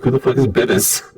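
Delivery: ordinary talk, nothing marked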